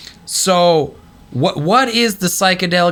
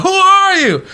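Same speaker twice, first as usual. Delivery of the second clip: much higher, louder startled question; awed cry; 6 dB louder than usual. Retold or imitated. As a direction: cry out in shock at the vision. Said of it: "when he's like".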